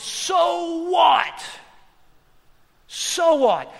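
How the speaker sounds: громкое «So what?», выкрикнуто высоко; emphatic shout. Retold or imitated. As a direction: hit it hard, dismissive. Pastor's retort, shouted.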